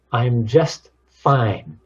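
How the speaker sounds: slow, "just" punched, then a pause before "fine"; stress on just. Shown as a demonstration. Showing different tones on one answer, "just" stressed. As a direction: hit "just" and let "fine" fall away. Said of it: "Those different tones of voices".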